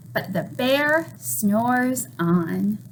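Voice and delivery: slow, drawn-out storybook refrain; sleepy refrain. A narrator's reading. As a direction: let the line drift off like the bear sleeps. Refrain read to children.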